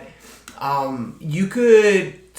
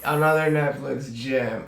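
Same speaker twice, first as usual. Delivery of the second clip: ordinary delivery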